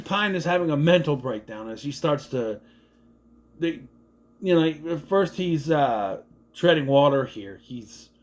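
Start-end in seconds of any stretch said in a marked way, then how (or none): none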